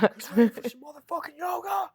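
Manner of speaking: a very high screaming workout-guru yell; over-the-top yoga drill sergeant roaring the class to start; fitness hype-man shout. Retold or imitated. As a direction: scream it like a maniac fitness infomercial. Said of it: "D D P yoga?".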